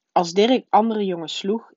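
ordinary delivery, nothing marked

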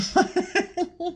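high burst of real laughter; short high laugh. Real, not acted. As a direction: laugh out at the joke. Laughing at mama's craziness.

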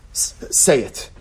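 slow, whispered nudge; whispered urging. Acted. Teacher nudging students to speak, slow whisper. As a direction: breathe it out slowly.

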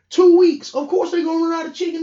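"Two weeks" yelled up high and loud; exasperated shout. Real, not acted. Yelling at complainers about the sandwich wait, loud. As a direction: blast "Two weeks" in disbelief.

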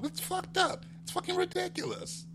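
usual speaking voice

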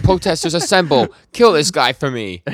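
loud, high mock battle cry; rallying shout. Denji summoning protesters against Yoshida, mock rallying shout. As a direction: call out to a crowd, hammed-up command. Retold rallying call to a crowd.

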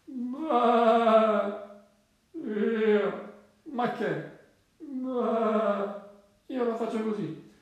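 high, slow bleating goat voice; storybook goat, sleepy and drawn-out; half-asleep goat. Acted. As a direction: bleat the words out, long and drowsy. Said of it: "una capra mezza addormentata".

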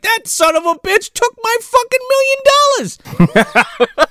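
mock outrage. Duncan raging about the stolen money, high and laughing. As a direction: squeal it in comic fury. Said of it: "he's like".

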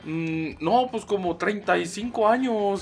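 slow, high mock chilango drawl; lightly voiced proud chilango friend; chilango accent. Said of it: "él habla así orgullosamente".

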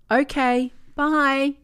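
put-on chirpy little girl saying bye; girl saying goodbye to her grandmother, cheery. A narrator's reading. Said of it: "Abby said in a cheery voice".

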